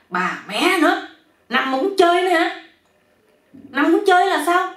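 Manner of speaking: high, loud outraged shouting; exasperated yell. A narrator's reading. Man bitten by mosquitoes cursing them, exasperated yell. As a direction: yell it out at the top of your pitch. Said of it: "Cái đó ảnh mới la lên nói".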